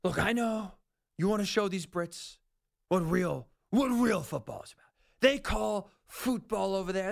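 usual speaking voice

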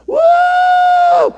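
high, loud "Woo"; loud excited whoop; joyful whoop. Real, not acted. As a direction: whoop with joy. Celebrating the check.

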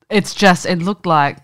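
normal talking voice

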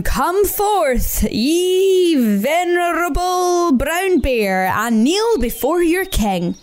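slow, grand mock-regal summons; grand Badger. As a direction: summon the bear like a king. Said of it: "said Badger grandly".

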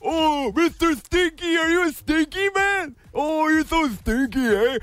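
put-on high, slow teasing baby talk to the dog; pet baby talk. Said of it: "that creepy voice".

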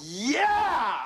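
high, loud whoop of delight; a big happy yeah; excited cheer. Real, not acted.

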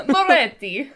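playful rolled-R Italian Moretti; Italian accent. Pretend. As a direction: lean into the Italian sounds, laughing. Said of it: "in the correct Italian accent".

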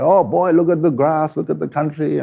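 mock old man, high and mournful; old fella lamenting. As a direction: point out the grass like a fretting old man. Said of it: "it'd be like".

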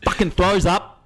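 high-pitched exasperated call; mock fielders' yell. Teammates yelling at the fielder's bad throw, mock yell.

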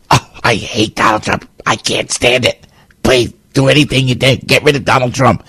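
a hint of a mocking, begging voter; mock Trump hater. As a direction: sneer it like a pleading Trump hater.

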